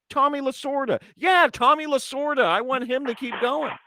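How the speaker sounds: high-pitched mock insistence; worked-up imitation of an impatient friend; retold exasperation. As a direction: act out finally getting the name across. Acted-out quarrel, naming the man.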